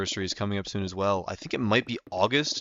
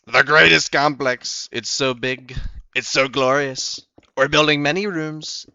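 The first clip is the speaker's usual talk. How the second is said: loud, higher-pitched bragging politician impression; Donald Trump; 3 semitones higher, 5 dB louder than usual. Streamer narrating his building project in character, boastful politician impression. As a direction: boast grandly with stretched, punched superlatives. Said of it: "I can do a much better Trump impression".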